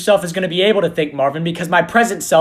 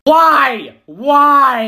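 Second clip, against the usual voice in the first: loud, high repeated why; anguished cry; 8 semitones higher, 5 dB louder than usual. Real, not acted. Friend reacting to the Teletubbies fact, anguished loud why. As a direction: cry out in disbelief at the news.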